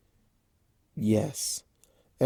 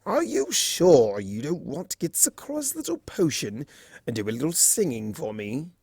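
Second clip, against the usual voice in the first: lightly voiced wheedling trickster; a hint of sly flirtatious coaxing; coaxing Discord; 3 semitones higher than usual. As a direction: trickster fluttering his lashes at her.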